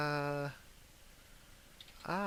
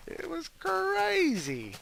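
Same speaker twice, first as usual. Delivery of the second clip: amazed; 5 dB louder, much slower than usual. Real, not acted. Amazed at huge dogs.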